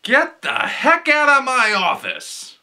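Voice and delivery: outraged boss shouting; angry producer. Acted. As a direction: throw him out of the office. Producer throws out pitcher.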